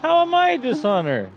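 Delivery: high-pitched protesting question; mock indignation. Real, not acted. Co-host called a dishonor, mock-indignant protest. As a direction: protest the teasing, pitch way up then sliding down.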